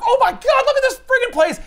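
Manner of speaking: high-pitched comic astonishment; mock amazement. Army arriving at the strange building, high mock amazement. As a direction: exclaim it as a gobsmacked soldier.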